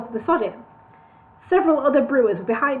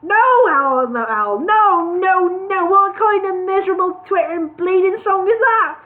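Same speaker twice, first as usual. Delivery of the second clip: angry rejection; 8 semitones higher, 5 dB louder than usual. Acted. Al angrily rejecting the song.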